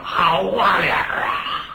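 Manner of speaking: slow, drawn-out mocking shout from the crowd; slow, drawn-out mock cheer from the audience; audience heckle. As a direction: bellow it like a heckler in the stalls. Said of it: "台底下嚷嚷".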